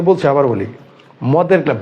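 ordinary talk

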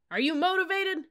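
rally the troops at full volume; drill yell. Acted. Sergeant yelling to fire up his cadets. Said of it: "First Sergeant Stockel yelled".

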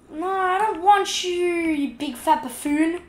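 playfully scolding the fish; mock insult voice.

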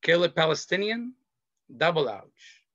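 ordinary talk, nothing marked

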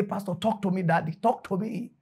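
soft, small begging voice; hushed pleading. Acted. Young man begs pastor to speak, hushed pleading. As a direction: plead quietly, as if awestruck.

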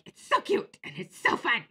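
high, loud mock gush; gushing catchphrase. Vlogger mimicking her old catchphrase for fun, mock gushing. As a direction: squeal it as a hammed-up catchphrase. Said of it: "back when I was like".

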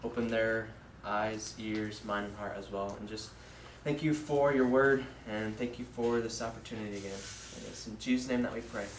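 low, subdued prayer. Real, not acted. Young preacher opens the evening with God, low prayer. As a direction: pray quietly and earnestly in a low, even voice.

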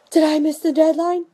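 imitating a worried customer asking; mock panicked customer. Customer calls the seller, asking in mock worry. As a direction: caller worried she missed it. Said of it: "you're gonna get calls saying".

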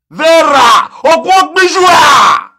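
loud angry shout of her name; angry call-out. Real, not acted. Commentator shouting at Vera in outrage, loud call. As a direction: yell her name across the room in outrage.

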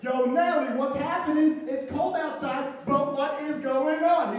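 loud, high, hyped-up holler; excited shouted greeting. Acted. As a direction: holler it out to a buddy with big energy. Greeting friend Nelly in the bit.

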